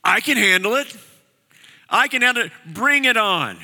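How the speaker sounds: high, cocksure boast; brash bravado, pitched well up, itching for a fight; cocky confidence. Acted. Preacher voices Peter's cocky reply.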